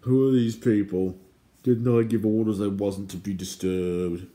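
cross, blustering royal complaint; irate shout. Acted. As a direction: play an outraged little king. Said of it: "he shouted".

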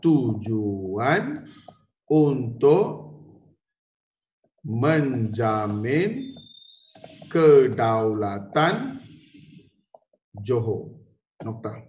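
very slow, word by word; slow dictation. Shown as a demonstration. Teacher dictating notes to students, very slow. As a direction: dictate each word so they can write it down. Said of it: "saya baca, kamu tulis".

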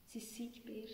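whispered bad news; a young woman whispering that his mother is sick. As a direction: drop to a hushed whisper. Delivers bad news to Peer.

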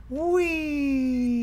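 long high gleeful whee; excited whee. Real, not acted. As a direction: sing out the ride's thrill. Riding a mine cart.

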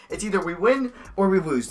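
ordinary talk, nothing marked

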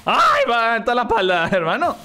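animated gamer yelp; startled exclamation. Real, not acted. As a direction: react with amused shock to a painful hit. Hit in the back during fight.

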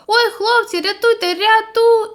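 high, loud panicked cry for rescue; frightened call for help. Acted. Drowning boy calls friends for help, panicked. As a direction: shout for help as he goes under. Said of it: "перелякано, пирхаючи, загукав".

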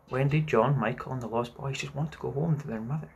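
ordinary delivery, nothing marked

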